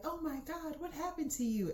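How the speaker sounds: high-pitched mock teacher concern; imitating alarmed teachers. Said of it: "the teachers finally were like".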